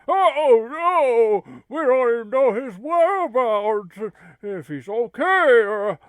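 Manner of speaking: hammed-up worried shout; alarmed outcry. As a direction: panic over the missing friend. Panicked at Billy sent away.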